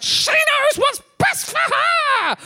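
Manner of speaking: very high, slow, outraged shriek; furious shout. Retold or imitated. Comedian exploding at the barista, a high drawn-out shout. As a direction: bellow it out, stretched and shrill with rage. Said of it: "I was like".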